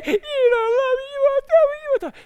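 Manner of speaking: very high falsetto whine; hammed-up whining nag; nagging. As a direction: pester in a mock-tearful squeal. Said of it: "She nagged him day after day".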